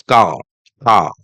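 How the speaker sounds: CAR as 'cah', no R at the end; British car with open 'ah' and silent R. Shown as a demonstration. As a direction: drop the R in car. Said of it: "often not pronounced at all".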